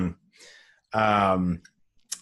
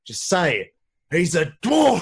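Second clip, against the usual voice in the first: higher, louder mock shout; intense husband; 9 semitones higher, 6 dB louder than usual. Husband shouting at his wife, mock shout. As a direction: build to a mock shout on dwarf.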